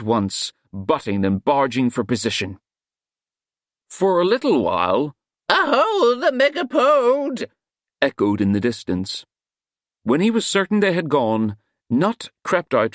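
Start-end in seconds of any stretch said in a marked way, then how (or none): 5.5-7.5 hunting cry. A narrator's reading. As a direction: call the chase as it moves off. Cry echoing as hunt leaves.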